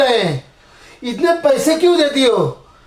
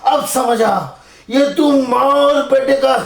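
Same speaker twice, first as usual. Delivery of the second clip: ordinary talk, nothing marked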